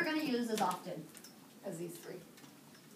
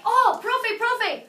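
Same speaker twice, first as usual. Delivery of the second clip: loud, high, drawn-out mock cheer; excited team shout; 6 semitones higher, 9 dB louder, much slower than usual. Students announcing their team name to teacher, excited shout. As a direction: call out to teacher like an eager team. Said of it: "And you can tell me".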